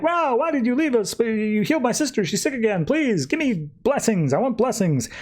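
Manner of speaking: high-pitched mock begging; pleading. Crowd begging Rao for help.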